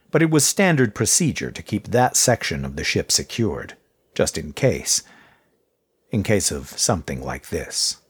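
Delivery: ordinary delivery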